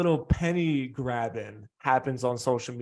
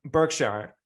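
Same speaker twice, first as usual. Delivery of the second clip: usual speaking voice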